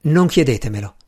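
usual speaking voice

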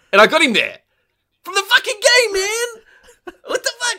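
high, loud and excited; can't believe it, pitch shoots up; excited disbelief. Real, not acted. Telling a friend how the game map matched reality, excited disbelief.

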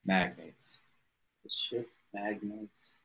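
normal talking voice

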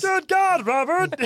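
Frasier impression. Playing Frasier mashup.

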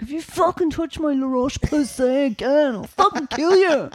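imitating a posh Dublin lad in a rage, laughing; posh Dublin accent, mock furious. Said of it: "a boy with a posh Dublin accent".

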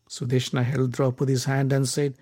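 ordinary delivery

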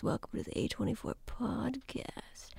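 breathy, near-silent deathbed whisper; dying whisper. Shown as a demonstration. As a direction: rasp it out like she's on her deathbed. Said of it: "she sounds like she's dying".

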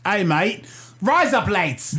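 mock Aussie greeting and catchphrase; broad, playful Australian accent. Shown as a demonstration. Comedian showing friends his Australian trick, mock Aussie accent. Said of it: "razor blades in Australian".

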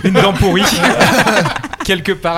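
laughing. Real, not acted. Joking donation offer to chat.

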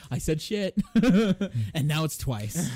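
high, giggly delivery through laughter; laughing. Real, not acted. Caught swearing on the podcast, laughing through it. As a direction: laugh helplessly at your own slip.

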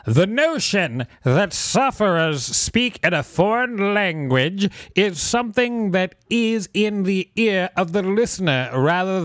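slow, high put-on lecturer voice; quoted professor. Reading out Nick Miller's explanation, slow high put-on professor voice. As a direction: act out the professor, measured and drawn out. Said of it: "has explained".

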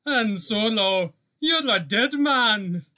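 put-on Scottish accent, drawn out like a gangster's threat. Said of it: "in honor of my Scottish roots".

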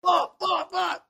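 high-pitched, half-joking curse; mock frustrated squeal. Retelling how he reacted to his wife, in a high mock squeal. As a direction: squeak it out like a cornered husband. Wife says he can't coach.

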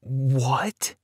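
stunned question with rising tone; disbelieving, upturned exclamation; shocked. A narrator's reading. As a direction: ask it in open-mouthed shock. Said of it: "I opened my mouth in shock".

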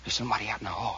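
low, quiet dread; a man whispering a fearful warning; frightened hush. Acted. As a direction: whisper it, scared of the noise. Fearful noise in hallway.

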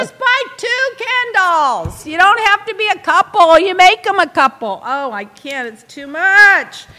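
high, loud exasperated rant. Real, not acted. Rant continues.

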